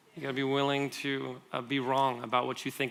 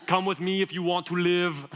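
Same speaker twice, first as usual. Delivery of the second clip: loud mock Austrian accent; movie catchphrase in a thick Austrian accent; Arnold Schwarzenegger; 3 semitones higher, 4 dB louder than usual. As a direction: do a hammy Austrian action star. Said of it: "think about some of the movies he's been in".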